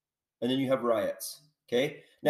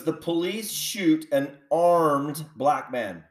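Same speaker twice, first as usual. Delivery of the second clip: "armed" drawn out and punched in a slow phrase; slow down and lean hard on "armed"; stress on ARMED; slower than usual. Real, not acted. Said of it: "I said armed".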